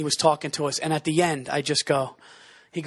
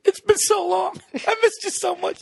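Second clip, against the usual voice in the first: high, gushing fake-tearful reunion; hammed-up weepy voice; mock crying; 13 semitones higher than usual. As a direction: pretend to sob through the greeting. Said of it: "and then you cry".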